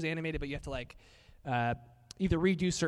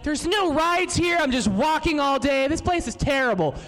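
put-on whiny kid; 10 semitones higher, 4 dB louder than usual. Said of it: "You're like".

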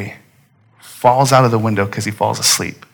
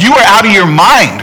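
disbelieving, pitch swooping up high; incredulous outburst; 7 semitones higher, 3 dB louder than usual. Acted. Preacher imagining himself as the parent confronting Paul, incredulous. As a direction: react as if he's crazy.

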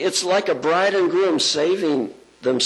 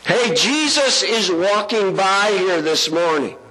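pretend yell; 7 semitones higher than usual. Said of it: "and yelled".

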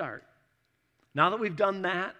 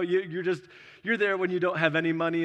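usual speaking voice

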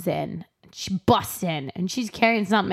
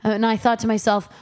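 usual speaking voice

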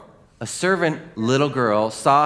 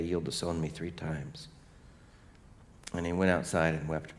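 hushed sermon; 4 semitones lower, 5 dB quieter than usual. Acted. Preacher reflects on Jesus' forgiving gaze, hushed. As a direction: draw them in with quiet conviction. Solemn retelling of the gospel.

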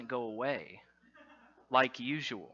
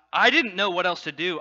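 usual speaking voice

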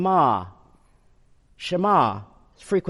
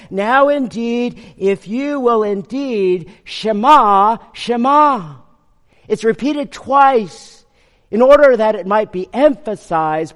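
higher, louder, slow impassioned preaching; fervent preaching; 5 semitones higher, 5 dB louder, slower than usual. Acted. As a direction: lift the congregation with a fervent cry to God. Emphatic repeated shema.

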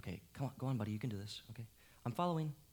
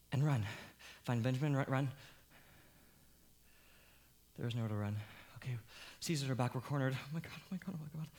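ordinary delivery

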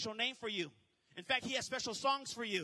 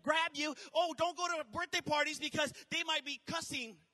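higher, louder alarmist voice; mocking a fearful churchgoer, higher and louder; mock fearful warner; 6 semitones higher, 4 dB louder than usual. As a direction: lampoon the overcautious believer. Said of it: "we can't live in a, you know".

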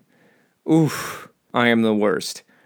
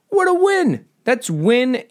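mock triumph; 10 semitones higher, slower than usual. Real, not acted.

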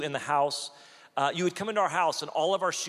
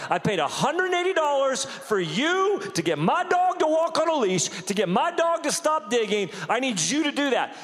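building to a loud, higher-pitched indignant demand; retold upset rant; 4 semitones higher, 5 dB louder than usual. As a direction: replay your fuming complaint, rising as you go. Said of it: "And I got upset".

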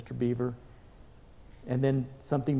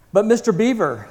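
higher, louder, falling cry of appeal; urgent plea; 5 semitones higher, 7 dB louder than usual. A narrator's reading. Lucy protesting to Mister Beaver, urgent plea.

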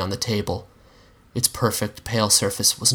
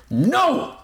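a much higher, shouted NO. A narrator's reading. The narrator shouting his refusal back at the Monster. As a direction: throw the refusal back hard. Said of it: "shouted back".

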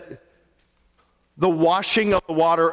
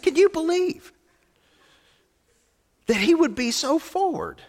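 indignant wife; 12 semitones higher than usual. Retold or imitated. Wife retold in disbelief.

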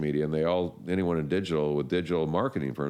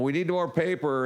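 much higher, eager demand; mock urgent excitement; 11 semitones higher than usual. Ad staff demanding paper, hammed-up excitement. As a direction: shout orders as if business is booming. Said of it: "then they're going".

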